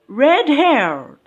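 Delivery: "red-haired" high and emphatic, weight on "haired"; stress on second part. Shown as a demonstration. Teacher showing adjective stress, "red-haired" stressed on "haired". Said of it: "on the second part".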